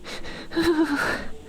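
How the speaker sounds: long, high relieved sigh; a happy sigh of relief; contented sigh. Acted. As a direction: sink into the warmth with a sigh. Snuggling under blankets, relieved.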